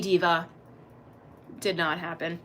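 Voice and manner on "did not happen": ordinary talk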